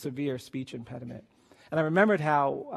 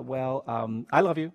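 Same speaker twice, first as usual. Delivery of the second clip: reluctant mumbled build-up, then blurted out; grudging, halting child's declaration; insincere; 2 semitones lower, 5 dB louder than usual. Retold or imitated. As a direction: stall with hesitant fillers, then toss it out insincerely. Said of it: "as insincerely as I possibly could".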